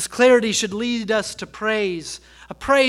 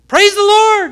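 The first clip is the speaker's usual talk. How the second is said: loud, high praise shout; calling out in worship; 11 semitones higher, 10 dB louder than usual. Retold or imitated. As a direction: burst out with it uncontrollably. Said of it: "She would just call out".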